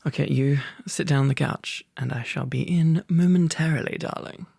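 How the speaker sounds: usual speaking voice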